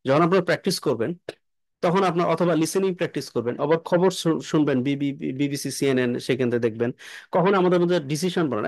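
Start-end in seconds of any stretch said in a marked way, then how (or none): none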